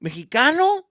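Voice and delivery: high, loud incredulous question; can't believe he was Mexican; shocked disbelief. Real, not acted.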